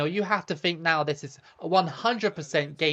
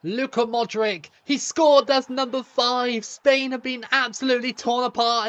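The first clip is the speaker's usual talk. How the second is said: excited goal shout; 6 semitones higher than usual. Real, not acted. YouTuber celebrating Modric's fifth goal, excited shout. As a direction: burst out as the ball goes in.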